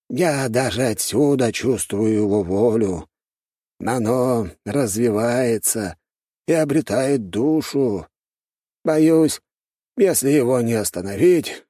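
high, slow elder voice; worried old master. A narrator's reading. Elder in green warns about the growing tree, worried.

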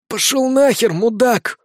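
hoarse. A narrator's reading. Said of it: "Голосом сиплым от непрекращающегося ора".

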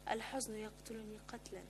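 hushed, sorrowful confiding; soft undertone, barely projected; near-whisper. A narrator's reading. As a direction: confide it very softly. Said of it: "فقالت لي همسا".

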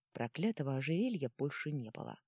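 ordinary delivery, nothing marked

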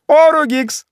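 very high, loud exclamation; astonished cry. A narrator's reading. Executioner hearing his own family name, astonished cry. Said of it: "вскричал палач".

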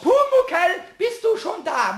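hoch und laut gerufen; rufen. Shown as a demonstration. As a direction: ruf nach dem Kobold. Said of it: "Und jetzt musst du rufen". (Vorführung: so musst du laut rufen)

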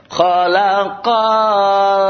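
"khalaqal" with a long, dragged last syllable; common misreading. Retold or imitated. Teacher imitates how many people read, "khalaqal" dragged at the end. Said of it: "Banyak orang nanti yang baca".

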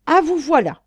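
cri furieux, plus aigu et bien plus fort; l'interpeller avec violence; violent outburst. A narrator's reading. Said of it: "s'écria-t-il avec violence". (éclat furieux du jeune homme qui barre la route)

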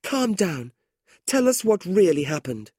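high, urgent plea; begging. A narrator's reading. Kate begs her angry brother, high and urgent. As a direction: beg him gently but urgently.

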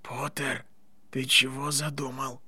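very soft, suspicious whisper. A narrator's reading. Draco questions Potter's plan on the side, whispering. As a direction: whisper it privately. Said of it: "прошептал Драко".

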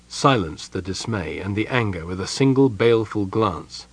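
ordinary delivery, nothing marked

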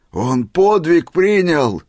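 high, loud, drawn-out exclamation; crying out. A narrator's reading. Old man hailing Misha's great deed, crying out. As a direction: proclaim it slowly at full voice. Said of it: "крикнул Семён Устиныч".

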